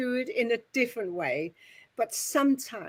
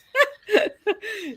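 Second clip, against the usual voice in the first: high burst of laughter; 11 semitones higher, 7 dB louder than usual. Retold or imitated. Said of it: "seems to be a laughter with it".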